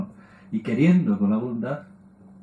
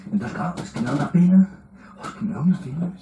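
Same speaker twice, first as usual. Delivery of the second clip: higher sighing mother voice; 5 semitones higher than usual. Retold or imitated. Mother pitying her crying baby, higher and sighing. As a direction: pity in every word, a touch hammed-up.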